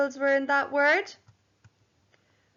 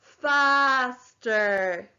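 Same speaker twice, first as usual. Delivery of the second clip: FASTER drawn out into two long syllables; syllable counting; 3 dB louder than usual. Shown as a demonstration. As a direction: stretch FASTER slowly, syllable by syllable. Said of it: "Let's count the syllables".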